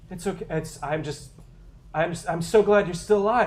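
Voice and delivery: high, loud, relieved survivor voice; eager comms contact. Acted. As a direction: gush with relief over the radio. GM voices NPC Kyle.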